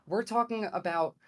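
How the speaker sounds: ordinary delivery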